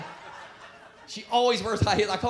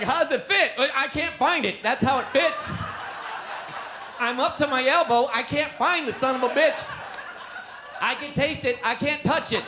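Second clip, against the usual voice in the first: mildly raised voice of the wife; wife asking; 6 semitones higher, 3 dB louder than usual. Retold or imitated. Wife teasing husband about the thong fit, slightly higher voice. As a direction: lightly play the wife. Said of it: "My wife's like".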